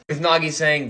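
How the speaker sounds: usual speaking voice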